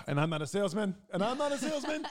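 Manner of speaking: high, loud, laughing; laughing protest. Real, not acted. Defending himself to the listeners, a high laughing protest.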